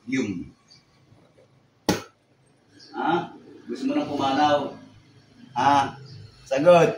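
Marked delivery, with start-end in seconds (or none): 5.5-6.9 loud, forceful command; sharp demand. Real, not acted. Healer interrogating a possessing spirit, sharp loud demand. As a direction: demand an answer, raising your voice.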